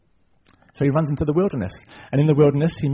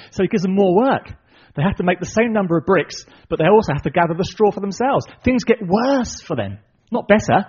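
ordinary talk, nothing marked

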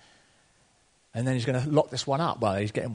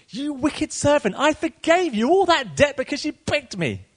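angry rebuke; 12 semitones higher, 4 dB louder than usual. Acted. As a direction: scold the wicked servant. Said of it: "said to him".

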